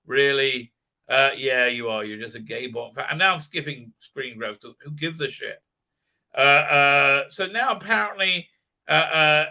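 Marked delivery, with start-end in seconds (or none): none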